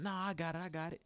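opponent insisting. Acted. Guarded player telling his teammate he's got it, lightly mimicked insistence.

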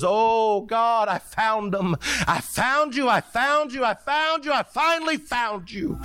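high, drawn-out cry of relief, acted out; retold weeping cry. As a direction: imitate an overwhelmed man crying out to God. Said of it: "and his voice broke".

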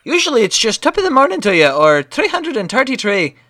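badly faked Irish brogue; Irish accent. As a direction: apologize in a put-on Irish lilt. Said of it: "saying these words in my Irish accent".

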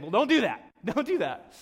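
mock stern warning; emphatic warning. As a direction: scold them with a grin. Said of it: "he's saying".

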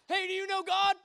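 high, loud, over-eager question; mock-blurt it at a stranger before nerve fails; blurted opener. Teen accosting first guy on the street, loud blurt.

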